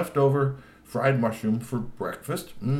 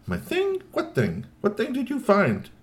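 puzzled questions; 4 dB louder than usual. Acted. G P confused.

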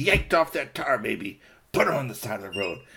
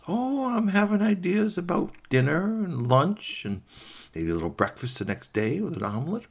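scheming fox; 9 semitones higher than usual. Acted. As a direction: draw out each meal idea. Fox muses on meals.

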